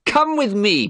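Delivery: high, loud, commanding summons; sudden loud call. Acted. Dorian suddenly calling Basil upstairs, loud and high. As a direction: fling the invitation out high and loud.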